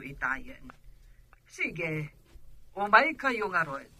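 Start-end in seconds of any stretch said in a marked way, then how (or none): 1.5-3.9 very faint voice on the line; softly, as heard through a telephone; distant, hushed phone reply. Acted. Auntie heard over a phone call.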